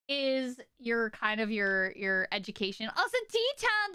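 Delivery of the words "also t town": sudden high-pitched call to a viewer; high, eager shout-out; excited shout-out. Real, not acted. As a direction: pipe up brightly to thank someone.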